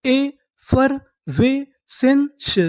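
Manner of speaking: Effervescence stretched out slowly, rising high; very slow model word. Shown as a demonstration. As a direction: spell out effervescence slowly for the class. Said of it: "तो हमारी पूरी meaning क्या बनी?". (Effervescence बहुत धीरे, ऊँचे सुर में)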